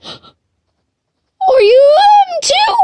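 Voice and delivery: gasp, then drawn-out high squeal; alarmed shriek. Real, not acted. Player suspecting another attacker, alarmed shriek. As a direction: squeal the question slowly, way up high.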